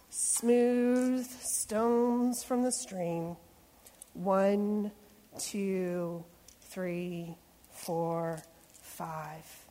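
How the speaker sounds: slow counting. Acted. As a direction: count the stones out for the kids. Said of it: "hearing how I'm reading the story".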